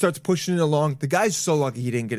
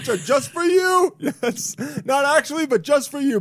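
mock flattery; 10 semitones higher than usual. Mock cheer for the cop.

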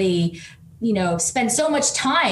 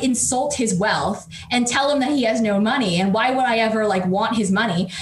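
ordinary delivery

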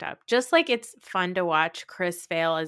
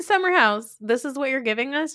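high, louder mock complaint; hammed-up viewer outrage; mock incredulous protest; 8 semitones higher, 4 dB louder than usual. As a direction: challenge the show in disbelief. Acts out viewer's protest to show.

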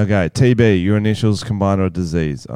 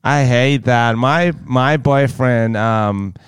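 lightly voiced American girlfriend, lingering on words; put-on American girlfriend drawl; American girlfriend impression; slower than usual. Pretending to be own girlfriend discussing the boyfriend, light American voice. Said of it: "he has to impersonate his mysterious American girlfriend".

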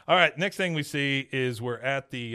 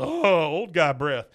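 mock disgust; 4 semitones higher, 7 dB louder, slower than usual. Disgusted reaction, retold.

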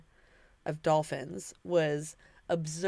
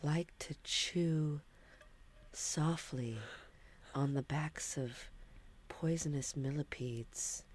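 hushed storytelling; 3 semitones lower than usual. Pretend. Host telling Grace about lemurs and millipedes, hushed.

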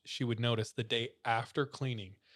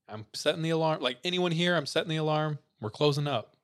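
calling out loud and high into an empty building; raised, carrying call, half acted; retold yell; 5 semitones higher, 5 dB louder than usual. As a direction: shout it down the basement stairs to anyone still there. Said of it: "he yells".

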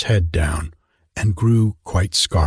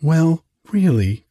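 cried out; 7 semitones higher than usual. A narrator's reading. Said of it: "cried Hans".

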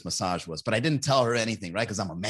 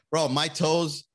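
ordinary talk, nothing marked

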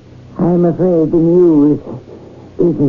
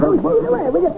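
terrified plea; 13 semitones higher, faster than usual. Acted. Shopkeeper attacked by captain.

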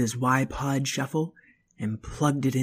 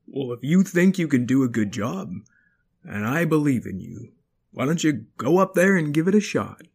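dad voice. Acted. Father voice.

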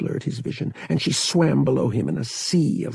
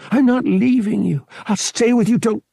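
louder, higher, insistent; stubborn refusal; 11 semitones higher, 6 dB louder than usual. A narrator's reading. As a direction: dig in and refuse to leave her. Han refusing to leave her.

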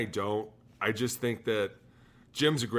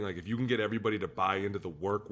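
normal talking voice

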